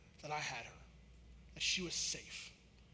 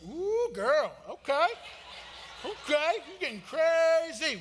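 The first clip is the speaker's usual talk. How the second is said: higher, louder hammed-up cheer, slowing at the end; a teasing dad's hammed-up whoop, higher and louder; mock excited; 8 semitones higher, 7 dB louder, slower than usual. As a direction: play up the surprise at her daring, big and slow. Dad teasing daughter's bravery.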